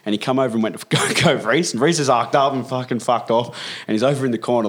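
ordinary talk

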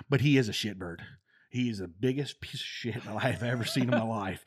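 loud, forceful verdict; blunt sergeant major. Retold or imitated. Sergeant major telling the young sergeant the truth, loud and blunt. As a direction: lay it down like a gruff boss.

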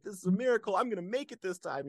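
newly clean man at breakfast with a friend, excited; retold excitement. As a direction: gush with hope. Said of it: "I'm all excited".

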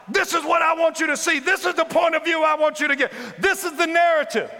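high shouted demand; insisting. Acted. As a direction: force your view on God. Elijah insisting on his view.

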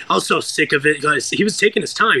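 ordinary delivery

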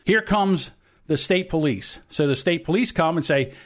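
normal talking voice

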